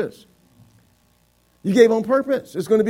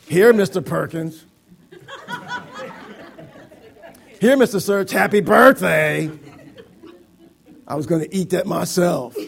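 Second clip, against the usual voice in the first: acted reluctant hand-over with heavy stress; grudging; 4 dB louder than usual. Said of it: "I can give grudgingly and say".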